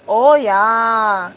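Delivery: playful mock German accent; drawn-out "Oh, yeah" with a German accent; best German accent. Streamer savoring the satisfying job, in a mock German accent. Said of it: "In my best German accent".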